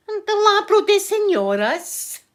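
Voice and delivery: high, loud Spanish phrase; loud mock insistence. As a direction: belt it out high, hamming up the confident tourist. Acting herself repeating botched Spanish.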